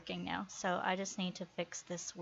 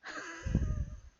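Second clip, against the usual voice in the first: high squeaky laugh. Real, not acted. Laughs after finding bug.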